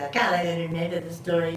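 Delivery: ordinary talk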